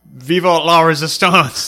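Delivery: loud, slow hammed-up French accent; slow, loud mock-French battle cry; teasing a French Canadian buddy with a hammed-up radio call.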